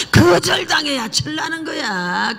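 high, drawn-out preacher's cry; emphatic cry. Real, not acted. Preacher telling the congregation how one matures, emphatic cry. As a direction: call it out high, stretching the words slowly. Preacher driving home the point.

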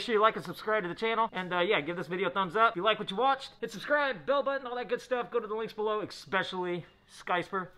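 muffled behind neoprene mask; speaking through dust respirator; through a face mask. Real, not acted. Seen 4: Mask covers mouth and nose throughout; references show it unworn.

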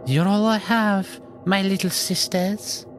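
high, slow put-on crone; pleading old lady. Chicken lady speaking to her hens, slow high pleading voice. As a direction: draw it out high and tender.

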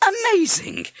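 very high, loud exclamation; amazed. A narrator's reading. Father hearing perfect mimicry, amazed. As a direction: burst out in wonder.

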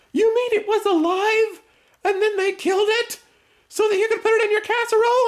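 put-on very high, shocked kids' voice; mock shocked family. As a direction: squeal it like appalled kids. Wife and kids voiced, shocked.

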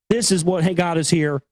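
ordinary talk

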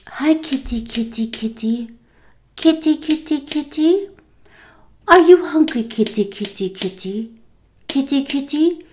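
high, coaxing kitty talk; cat-calling voice. Retold or imitated. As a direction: imitate someone calling hungry kittens. Imitates woman talking to kittens.

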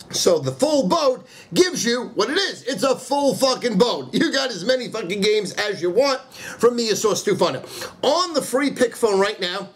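ordinary talk

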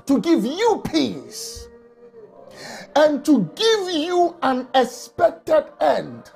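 ordinary talk

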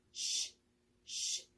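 쉬 as a soft, voiceless hiss of air; pouted SH sound. Shown as a demonstration. Teacher demonstrates sh, lips pushed forward, voiceless. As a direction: push the lips out and blow "쉬" without voice. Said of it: "입을 앞으로 내밀고".

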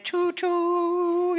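high playful train call; choo-choo train. Retold or imitated. Dad making choo-choo sound to feed baby, high playful. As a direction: sing it out like a toy train to a toddler.

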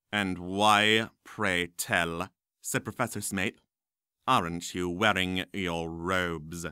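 low, slow, disdainful drawl; sneering professor. Acted. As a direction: look down on the boy. Smape questions the boy.

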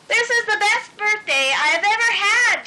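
high, gushing birthday joy; delighted exclamation. A narrator's reading. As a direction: burst out with it, pitch soaring. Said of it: "she exclaimed".

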